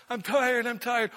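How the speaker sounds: high, loud whiny goose voice; silly clown. Pretend. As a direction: complain like a worn-out goose. Voicing tired lead goose.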